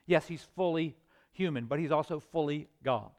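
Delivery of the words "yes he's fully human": normal talking voice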